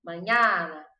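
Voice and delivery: mañana with a hint of Italian flair; playful Italian-style mañana; mock Italian again. Shown as a demonstration. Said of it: "Pode até fazer a mãozinha".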